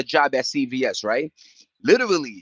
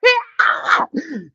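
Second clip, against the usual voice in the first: high laugh; 5 dB louder than usual. Real, not acted. After greeting a viewer.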